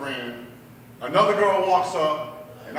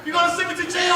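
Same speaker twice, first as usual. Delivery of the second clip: high, loud, outraged retort; freaking out; 12 semitones higher, 5 dB louder, faster than usual. Retold or imitated. Sister turns on her brother over jail, freaking out. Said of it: "My sister freaked out".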